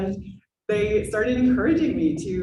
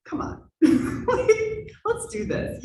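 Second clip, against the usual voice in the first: coaxing friend; 14 semitones higher, slower than usual. Retold or imitated. Friend urging her to convert.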